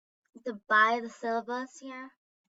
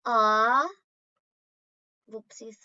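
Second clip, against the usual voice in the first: ordinary talk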